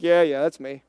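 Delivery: low, subdued mock agreement; resigned husband. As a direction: imitate a husband meekly giving in. Husband voiced responding to scolding wife.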